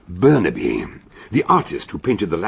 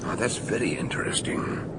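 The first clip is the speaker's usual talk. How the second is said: intrigued hushed whisper; 8 dB quieter than usual. Acted. Detective at the murder spot, whispering intrigued. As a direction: breathe it out, quietly intrigued.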